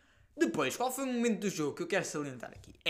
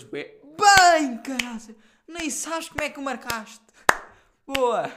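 much higher, far louder cheer; wild celebration; 10 semitones higher, 11 dB louder, slower than usual. Retold or imitated. Teammates cheering the scorer after the goal, much louder. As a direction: roar it with joy.

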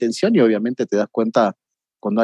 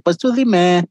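mock Mexican; 6 semitones higher, slower than usual. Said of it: "tú puedes decir".

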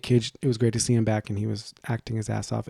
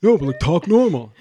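nagging brother; 6 semitones higher, 9 dB louder than usual. Retold or imitated. Acts brother nagging on speakerphone.

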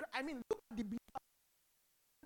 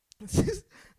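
laugh; 7 semitones higher, 10 dB louder than usual. Real, not acted. Preacher laughing at own joke.

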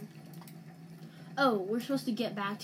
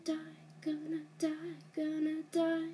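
panicked whine; 6 semitones higher, 3 dB quieter than usual. Real, not acted. About to die in game.

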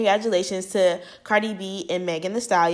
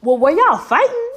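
higher, louder questioning; loud suspicious; 9 semitones higher, 7 dB louder than usual. Retold or imitated. Fan doubting the Megan story, loud high question. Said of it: "I was like".

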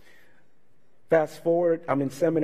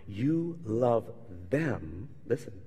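tender prayer; 6 semitones lower, 6 dB quieter, much slower than usual. Retold or imitated. Jesus praying to Father.

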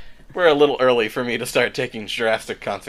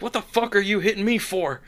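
angry brawler; 9 semitones higher than usual. Acted. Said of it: "he's like".

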